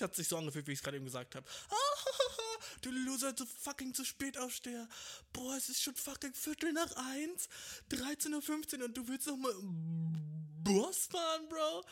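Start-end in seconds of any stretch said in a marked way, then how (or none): 1.5-2.8 high, loud mocking cackle; mocking laugh. Imagined clerks laughing at him, mocking cackle. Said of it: "So hat sich's für mich angehört". 2.8-11.8 much higher, mocking sneer; sneering clerk. Imagined clerk mocking the late riser, high sneer. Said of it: "So hat sich's für mich angehört".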